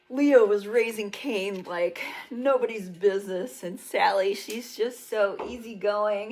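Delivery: ordinary talk, nothing marked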